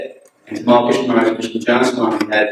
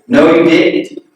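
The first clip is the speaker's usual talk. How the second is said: forceful denial; 6 semitones higher, 5 dB louder, slower than usual. Retold or imitated. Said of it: "he was so forceful and so".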